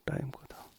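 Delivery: breathy off-mic whisper to someone nearby; whispered aside. Real, not acted. Teacher whispering a side remark mid-lesson. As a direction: drop to a whisper for a quick aside.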